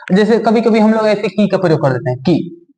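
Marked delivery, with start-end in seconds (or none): 2.2-2.7 "की" said with a very short i; "की" as a brief short-vowel syllable; short quick vowel. Shown as a demonstration. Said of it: "एकदम इसे जल्दी से बोलना है".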